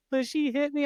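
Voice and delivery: very high mock sobbing whine; imitated tearful child. Dad imitating oldest daughter complaining, mock crying. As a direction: squeak it out like a kid in tears. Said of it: "breaks down in tears".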